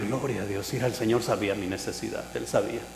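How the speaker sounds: soft, low murmured praise; retold grateful prayer under the breath; private thanksgiving. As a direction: thank God quietly, as if to yourself. Retells his private thanks to God.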